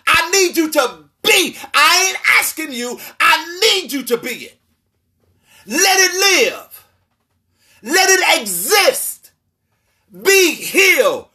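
preacher voicing God's command; fervent preaching. Real, not acted. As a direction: lean on every word like a decree. Preaching God's charge, slowing.

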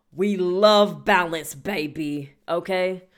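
slow, loud, drawn-out sass; sassy. Real, not acted. Host hyping emotional balance to listeners, sassy drawl. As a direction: stretch it out with sass.

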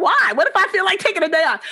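high, fast mock protest with a laugh; retold protest at the friend, high and quick; incredulous protest. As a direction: protest like skipping is your right. Friend tells her not to miss school.

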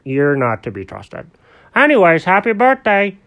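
Alfred's kindly voice. Acted. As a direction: talk brightly to a little buddy.

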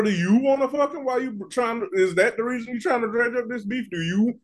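ordinary talk, nothing marked

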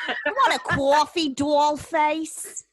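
put-on a light Al Pacino-style New York accent; New York gangster accent. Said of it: "Al Pacino movies, they're like".

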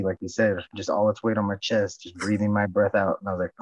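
normal talking voice

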